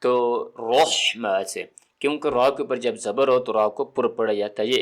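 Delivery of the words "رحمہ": slow رحمہ with a heavy, rounded R; full-mouthed raa. Shown as a demonstration. Teacher explaining raa with zabar, full-mouthed raa. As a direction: fill the mouth on the first syllable of رحمہ. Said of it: "منہ بھر کے آئے".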